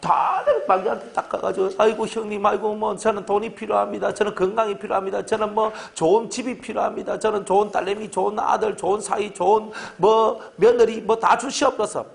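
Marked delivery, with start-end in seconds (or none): 1.8-12.1 pitched-up, wheedling list of wants; hammed-up whiny plea for blessings; mock begging prayer. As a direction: play a greedy petitioner pleading with God. Mimics people begging God for blessings.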